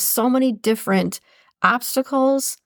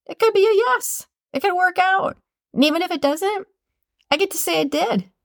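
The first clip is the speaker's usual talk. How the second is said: hopeful; 10 semitones higher than usual. Retold or imitated. Optimistic inner voice quoted.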